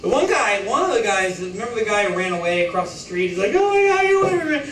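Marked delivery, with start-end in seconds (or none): 3.5-4.7 high, loud, fast frantic cry; hammed-up panicked yelp, words tumbling out; mock panic. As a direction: imitate a man fleeing in panic. Imitating man running away from Borat.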